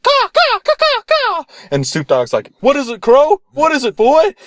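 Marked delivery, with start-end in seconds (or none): 0.0-1.4 very high urgent crow squawks; crow caws. Acted. Crow flying in to the feast, cawing. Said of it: "the crow flies in. He's like". 2.6-4.4 very high, coaxing questions; asking a pet. Acted. Rapper to his crow, coaxing pet-owner voice.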